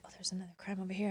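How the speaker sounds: fast hushed whisper of a secret tip. Retold or imitated. A caller tipping off the reporter, a quick secret whisper. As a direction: breathe it fast so the rival can't hear.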